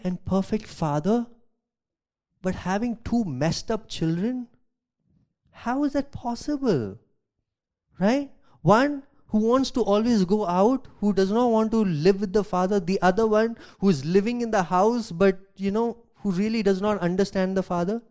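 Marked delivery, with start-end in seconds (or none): none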